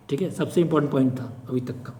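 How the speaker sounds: usual speaking voice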